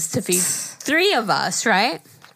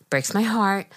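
ordinary delivery

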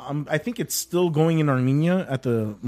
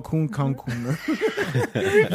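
slow drawn-out Armenian accent; much slower than usual. Shown as a demonstration. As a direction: stretch Ancun Cancun in an Armenian accent. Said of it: "You have to say it with the Armenian accent".

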